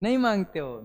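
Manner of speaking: ordinary delivery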